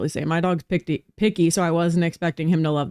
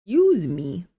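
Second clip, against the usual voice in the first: hiccuping; 3 dB louder than usual. Real, not acted. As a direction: excuse me after a hiccup. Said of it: "The hiccups".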